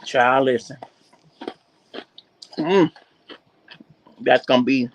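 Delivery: talking while crunching a snack; words round a crunchy mouthful; crunching chips. Real, not acted. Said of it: "You caught me right when I was crunching".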